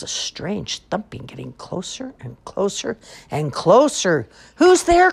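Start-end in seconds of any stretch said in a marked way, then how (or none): 1.5-4.3 mounting storybook tension; building suspense. A narrator's reading. Thumping approaches, suspense builds. 4.6-5.1 high, very loud challenge; startled high yell; alarmed cry. A narrator's reading. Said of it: "cried Bear".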